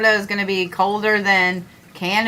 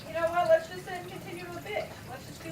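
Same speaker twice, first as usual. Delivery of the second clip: higher, softer whiny mock voice; mocking himself; 8 semitones higher, 15 dB quieter, faster than usual. Streamer mocking his own griping, in a higher put-on voice. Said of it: "and then being like".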